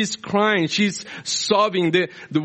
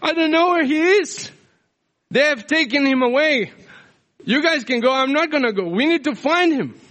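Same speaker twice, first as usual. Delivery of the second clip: desperate Mary; 9 semitones higher than usual. Retold or imitated. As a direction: refuse to leave, voice raised high and urgent. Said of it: "And Mary saying".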